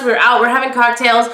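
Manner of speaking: ordinary talk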